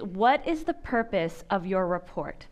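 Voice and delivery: usual speaking voice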